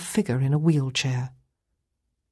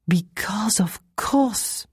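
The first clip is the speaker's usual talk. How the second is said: slow, higher, drawn-out cut-in; sudden interjection; 4 semitones higher, much slower than usual. Acted. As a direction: cut in on his answer, stretching each word. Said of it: "Madame cut in suddenly".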